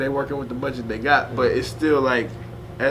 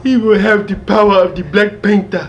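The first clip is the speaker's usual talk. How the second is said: loud laughing mock African accent; 8 semitones higher, 9 dB louder than usual. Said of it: "I hated the way he said".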